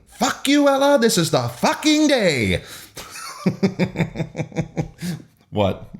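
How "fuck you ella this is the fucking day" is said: screaming. A narrator's reading. Narrator voices Hope screaming at Ella, screaming. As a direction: scream it, stretching the final words.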